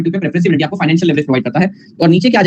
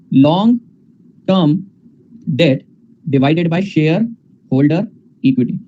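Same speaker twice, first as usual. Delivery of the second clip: dictated slowly; much slower than usual. Shown as a demonstration. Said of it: "तो ऊपर क्या आ जाएगा बेटा?".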